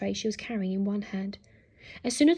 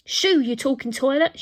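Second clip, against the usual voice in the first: high, loud shooing; shouting; 4 semitones higher, 7 dB louder than usual. A narrator's reading. Nanny chases the robot out, shouted scolding. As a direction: drive it off with a raised voice. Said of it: "she shouted".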